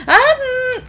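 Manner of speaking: startled um. A narrator's reading. Startled at the unicorn.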